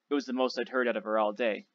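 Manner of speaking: usual speaking voice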